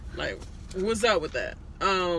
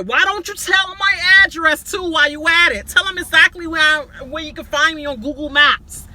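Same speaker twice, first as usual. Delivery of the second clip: angry Tommy; 8 semitones higher, 9 dB louder than usual. Retold or imitated. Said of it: "He's like".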